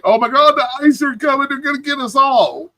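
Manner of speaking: high, hammed-up alarm; mock panic. Streamer mocking fear that ICE is coming, mock panic. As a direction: mimic someone panicking, pitch jumping up. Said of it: "You make it sound like".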